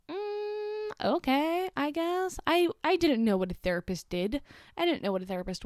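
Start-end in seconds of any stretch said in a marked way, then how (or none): 0.1-2.4 put-on much higher, a shrug of a twelve-year-old; reluctant kid voice. Young self answering parents about therapy, reluctant. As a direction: play her younger self giving in, unsure. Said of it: "And I was like".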